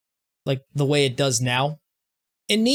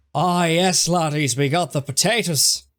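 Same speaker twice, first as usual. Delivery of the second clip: put-on Irish accent; 2 semitones higher than usual. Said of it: "my attempt at doing an Irish accent".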